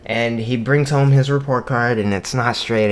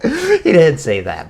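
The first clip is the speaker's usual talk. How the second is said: high, loud, slow, laughing words; 6 semitones higher, 6 dB louder than usual. Real, not acted. Teller takes back the son's crude excuse, laughing. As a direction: laugh through the retraction.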